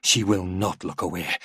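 ordinary delivery

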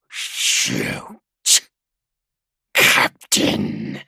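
loud, low rasping creature; monstrous traitor voice. A narrator's reading. Traitor goading the captain to shoot, monstrous rasp. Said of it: "the sound of the thing's voice".

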